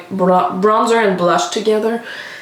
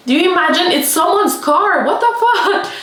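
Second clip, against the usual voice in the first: rising higher and higher in laughing disbelief; incredulous outrage; 8 semitones higher than usual. Real, not acted. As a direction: climb up in pitch, half laughing at how absurd it is. Outraged at borrowed client's car.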